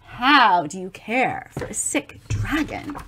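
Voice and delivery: plain reading voice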